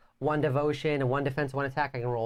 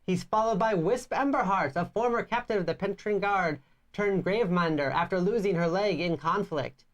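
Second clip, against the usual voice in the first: storybook reading voice; story narration; 5 semitones higher than usual. Acted. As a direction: read it like a fantasy tale. Reads game story narration.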